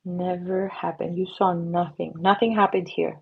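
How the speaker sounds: usual speaking voice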